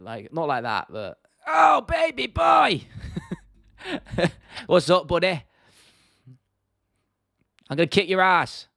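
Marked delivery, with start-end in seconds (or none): 1.5-2.8 high, drawn-out comic shout; Michael Bisping. Acted. Streamer hunting for a fighter's catchphrase, high stretched shout. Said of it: "Michael Bisping is hard". 4.7-8.7 higher, louder cocky fighter impression; younger Michael Bisping. Streamer taunting as a young fighter, raised punchy voice. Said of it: "That's that sort of younger Bisping".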